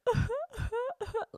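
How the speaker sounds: high gasping mock sobs; hammed-up sobbing noise; imitated sobbing. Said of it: "I'm audibly, like ... sobbing".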